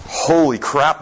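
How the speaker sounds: ordinary talk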